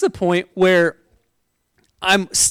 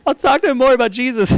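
higher, crying through the words to God; tearful prayer; 7 semitones higher, faster than usual. Retold or imitated. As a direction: pray it while tearing up. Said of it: "I just started crying".

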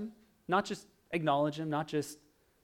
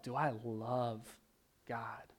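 hushed self-question; 4 semitones lower, 7 dB quieter, slower than usual. Acted. Searching self-question, trails to whisper.

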